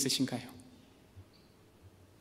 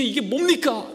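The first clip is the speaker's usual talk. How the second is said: exasperated complaint; 8 semitones higher, 3 dB louder, slower than usual. Retold or imitated. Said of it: "불평을 터치리며".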